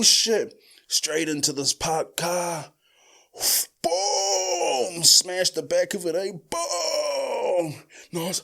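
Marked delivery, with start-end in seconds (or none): 3.8-5.0 high drawn-out boom imitation; vocal crash effect, pitched high; mock crash boom. 6.5-7.7 high drawn-out boom imitation; vocal crash effect, pitched high; mock crash boom.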